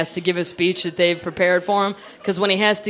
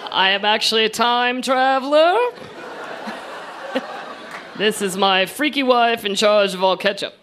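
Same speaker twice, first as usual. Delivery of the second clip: mock stiff John Kerry; 5 semitones higher than usual. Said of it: "come out and go".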